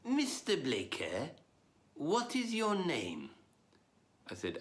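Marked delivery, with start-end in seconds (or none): 0.0-3.3 slow, ponderous rabbi's question; Yinglish-speaking rabbi. Acted. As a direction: stretch each word in a Yiddish-English accent. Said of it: "then he said to me".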